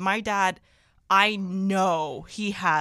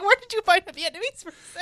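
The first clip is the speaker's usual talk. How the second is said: amazed agent; 10 semitones higher, 4 dB louder than usual. Retold or imitated. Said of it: "She's like".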